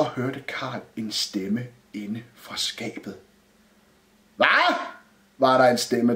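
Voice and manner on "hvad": high, questioning yell from inside a cupboard; shouted call. A narrator's reading. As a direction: call it out high, rising at the end. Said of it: "råbte inden for skabet med et stort ekko". (råbt spørgsmål)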